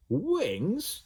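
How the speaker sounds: high rising question; baffled echo; flabbergasted. A narrator's reading. Ned amazed at Slime's offer, high question. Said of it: "the boy was flabbergasted".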